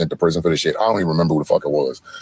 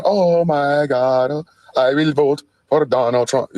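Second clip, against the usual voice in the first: lightly higher, slow mock sing-song; mocking the singer; 8 semitones higher, slower than usual. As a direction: drawl it out teasing her song. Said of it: "You out here going".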